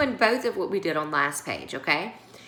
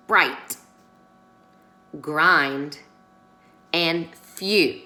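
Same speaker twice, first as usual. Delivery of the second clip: each word alone, clear and a little louder, long pauses between; reading spelling words aloud; 4 dB louder, much slower than usual. Shown as a demonstration. Teacher reading the spelling list for the class to echo, clear and spaced. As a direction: model every spelling word slowly for the class to repeat. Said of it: "So let's read them together".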